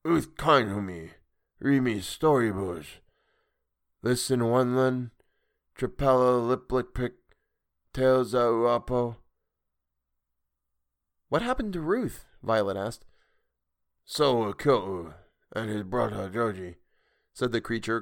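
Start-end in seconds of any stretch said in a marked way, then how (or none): 0.1-9.2 slow, broken speech of a fond sea creature; a monster recalling its only friend. Acted. 11.3-12.3 put-on much higher anxious girl's voice; a girl fearing bad news. Violet voiced in story. 14.0-16.8 understated cold anger from a sea creature; a monster naming the killer, icy. Acted. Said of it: "cold anger in its voice".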